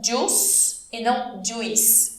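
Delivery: slow contrast of "Juice" said "djoos", then the wrong "juice" with the I; right versus wrong. Shown as a demonstration. Teacher recaps the right and wrong "Juice" for students. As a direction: contrast the two "Juice" takes slowly. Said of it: "isso é importante você perceber".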